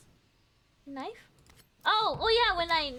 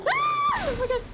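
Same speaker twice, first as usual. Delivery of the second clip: being chased; 5 dB louder than usual. Real, not acted. Sudden monster attack.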